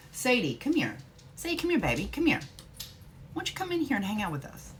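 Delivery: high sing-song calling the dog. Real, not acted.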